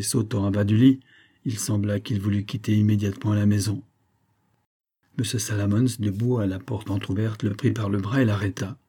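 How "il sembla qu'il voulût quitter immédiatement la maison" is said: ordinary delivery, nothing marked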